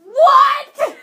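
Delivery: stunned, loud What; shocked shout. Real, not acted. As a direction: blurt it out in shock. Shocked reaction.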